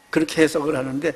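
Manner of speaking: ordinary talk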